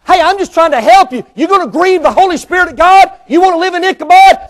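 loud, high preaching climax; full-force preaching. Acted. Preacher warning the congregation, loud and high. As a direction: lift the voice and preach it hard.

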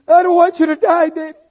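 put-on high, pleading small child; little girl's voice. Daughter begging her father not to die, high childlike plea. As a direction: beg like a frightened little daughter. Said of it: "she said".

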